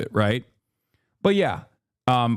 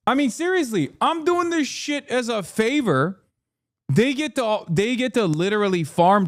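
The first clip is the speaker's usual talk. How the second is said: exasperated rant; 10 semitones higher than usual. Real, not acted. Venting about the festival.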